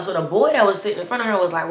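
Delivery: ordinary delivery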